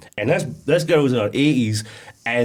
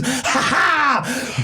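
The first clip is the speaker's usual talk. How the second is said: evil laugh; 11 semitones higher than usual. Retold or imitated. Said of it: "And he goes".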